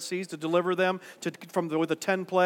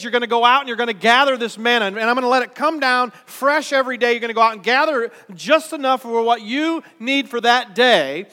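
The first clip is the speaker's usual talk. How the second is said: raised, emphatic preacher's voicing of God; God's directions, preached with rising energy; God giving instructions; 5 semitones higher, 8 dB louder than usual. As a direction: lay out the promise loud and high. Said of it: "God says".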